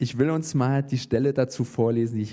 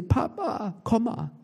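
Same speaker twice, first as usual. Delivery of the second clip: calling daughters; 9 semitones higher, slower than usual. Retold or imitated. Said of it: "dann schreien sie".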